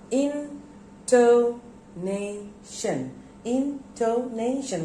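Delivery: INTONATION slowly chopped into four separate syllables. Shown as a demonstration. Teacher breaking a word down for pupils, spaced out. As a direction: pause after each syllable of INTONATION. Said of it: "there are four syllables".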